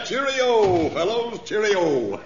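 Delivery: high sing-out in mock British; jolly, exaggerated British club farewell cry; mock club-British greeting. Newcomer greets the club fellows, imitating their British talk. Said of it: "getting to sound just like the rest of you".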